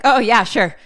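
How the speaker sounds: sarcastic. Retold or imitated. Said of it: "Rob's just like".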